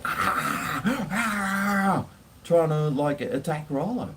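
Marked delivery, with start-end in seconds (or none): 0.0-2.0 high rough mock growl of an attacking dog; imitating a dog lunging. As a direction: snarl like the husky going for the neck. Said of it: "and starts, like".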